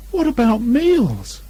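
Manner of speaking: a hint of a worried Pooh bear; lightly voiced Pooh. A narrator's reading. Stuck Pooh protesting to Christopher Robin, mildly dismayed.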